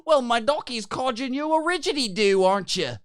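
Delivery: put-on high, loud, overdone Australian accent; mock Aussie slang. Answering a lawsuit threat in Australian slang, hammed-up Australian accent. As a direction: ham it up as a slang-spouting Aussie. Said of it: "and you're like".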